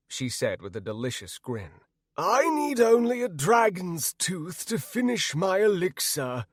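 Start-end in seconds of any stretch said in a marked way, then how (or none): 2.2-6.5 high-pitched crazed character voice; high, put-on madman voice; wild-eyed man. A narrator's reading. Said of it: "said a wild-eyed man".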